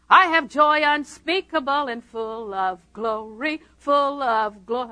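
dragged-out, very slow mock recital; mock joyless recital. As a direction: stretch each word as if joy were a burden.

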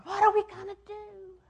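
high, slow, frantic cry; mock panicked wail; panicked holler. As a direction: cry out in panic from the cliff edge. Hollering off the cliff edge.

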